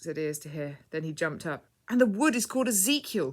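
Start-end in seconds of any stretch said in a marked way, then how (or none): 1.9-3.3 high, loud triumphant cry. A narrator's reading. Ears to Hear jumps up, solving the puzzle, triumphant. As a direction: crow it out like a solved riddle. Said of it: "he cried triumphantly".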